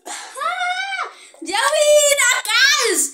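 loud, high victory shout; excited shouting. Real, not acted. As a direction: shout your win. Celebrating win.

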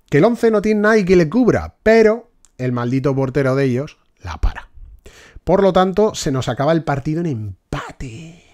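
normal talking voice

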